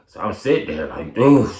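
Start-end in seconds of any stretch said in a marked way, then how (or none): 1.1-1.5 stunned exclamation. Retold or imitated. Reacting to drug high.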